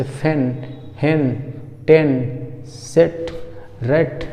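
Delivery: brief "eh" in फैन, हैन, टेन, सेट, रेट; short e vowel. Shown as a demonstration. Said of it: "इसका pronunciation होता है ए".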